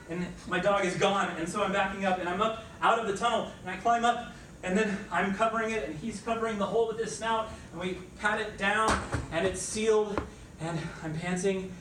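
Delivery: frantic telling. Acted. Frantic escape told breathlessly.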